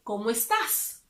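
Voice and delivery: final S in "estás" clearly sounded; pronounce the S. Shown as a demonstration. Teacher stressing the final S of "estás" for the informal form. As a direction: hiss the last S of "estás". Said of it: "you have to pronounce the S".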